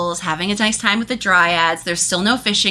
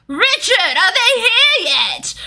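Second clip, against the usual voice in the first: put-on old wizard calling; 14 semitones higher, slower than usual. Wizard calls from upstairs.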